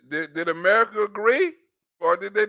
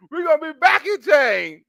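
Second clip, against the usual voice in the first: mock rallying cry; 5 semitones higher, 4 dB louder than usual. Mockingly reworks a quoted Biden line.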